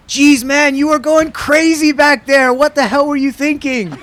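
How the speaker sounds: a hammed-up rival ribbing him after the race; Sebastian teasing. Rival after the race ribbing him, high loud mock disbelief. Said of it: "I'm not even gonna try his, his German accent".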